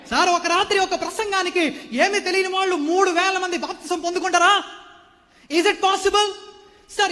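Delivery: loud, high preaching; fiery preaching. Acted. Preacher challenges the congregation, full force. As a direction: drive the question home to the church.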